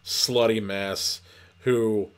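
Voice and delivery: ordinary talk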